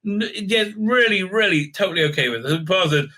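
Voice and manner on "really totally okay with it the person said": normal talking voice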